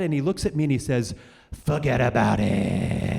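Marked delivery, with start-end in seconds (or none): 1.6-3.2 put-on slow, lower New York accent "fuggedaboutit"; Canadian officer's dry New York accent punchline; mock New York accent. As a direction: drop into a deadpan New York accent, drawn out. Canadian customs guy fakes New Yorker.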